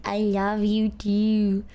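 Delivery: ordinary delivery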